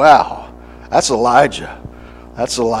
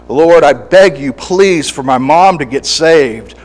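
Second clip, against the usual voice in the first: begging prayer; 2 semitones higher, 6 dB louder than usual. Acted. Preacher acting a believer begging God for his mom, loud and pleading. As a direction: beg God out loud, desperate.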